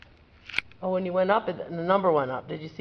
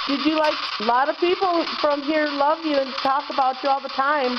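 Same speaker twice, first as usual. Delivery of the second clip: high, raised voice calling to spirits; EVP spirit call-out; 8 semitones higher than usual. Acted. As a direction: call out gently into the dark room.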